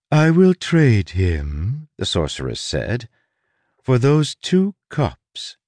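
slow, drawn-out sorceress. Acted. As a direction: savour each word like a bargain. Sorceress bargains.

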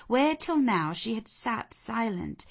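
ordinary delivery

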